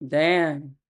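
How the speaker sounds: flat deadpan "Damn." falling low; emotionally numb "Damn.". Retold or imitated. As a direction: drop "Damn." like you feel nothing. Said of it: "I just be like".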